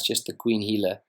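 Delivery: normal talking voice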